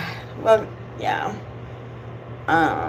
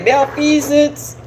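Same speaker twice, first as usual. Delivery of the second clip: usual speaking voice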